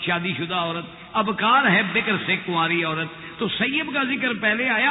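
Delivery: ordinary talk, nothing marked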